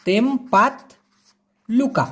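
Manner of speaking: তেমপাত লুকা said slowly and clearly; careful pronunciation. Shown as a demonstration. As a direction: model তেমপাত লুকা syllable by syllable. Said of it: "এরকম উচ্চারণ হবে".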